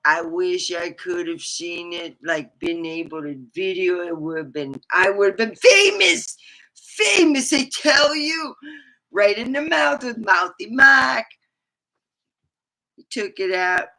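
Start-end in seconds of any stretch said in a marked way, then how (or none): 4.9-11.3 higher, louder gleeful bragging; excited boast. Real, not acted. To her audience about hitting Mouthy Mike, gleeful and loud. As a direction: crow about the lucky hit.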